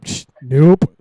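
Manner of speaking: loud scoffing psh, nope; dismissive scoff. Retold or imitated. Vacation list with his wife, loud dismissive scoff. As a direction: wave off Italy with a loud scoff. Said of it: "I was like".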